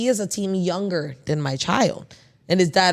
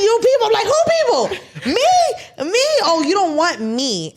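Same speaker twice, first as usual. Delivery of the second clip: very high, loud, incredulous squeal; hammed-up indignation, voice shooting right up; outraged disbelief; 14 semitones higher, 5 dB louder, slower than usual. Mom retelling her reaction to "you people", incredulous outrage.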